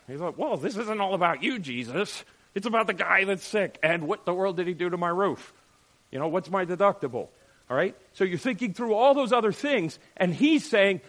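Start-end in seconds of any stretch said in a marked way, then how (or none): 0.4-7.3 louder, higher mock complaint; complaining man. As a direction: whine about the roof and the deductible.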